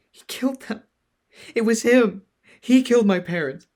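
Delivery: high, loud sobbing words; crying. Acted. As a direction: wail through tears. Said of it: "Vax cried".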